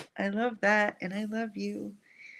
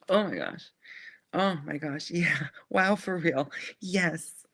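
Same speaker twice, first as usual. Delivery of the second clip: overwhelmed exclamation; 3 semitones lower, 5 dB louder than usual. Real, not acted. Reacting emotionally to the message.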